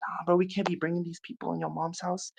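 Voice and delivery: very soft, confidential retelling; hushed aside. Retold or imitated. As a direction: drop right down as if pulling a friend aside. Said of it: "I grabbed him aside".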